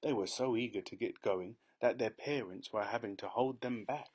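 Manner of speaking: ordinary delivery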